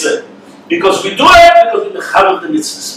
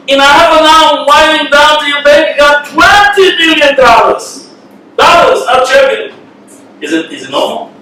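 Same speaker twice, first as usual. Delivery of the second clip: higher, incredulous outburst at his father; upset son; 6 semitones higher than usual. Retold or imitated. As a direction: protest that he waited so long. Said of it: "the son gets very, very upset".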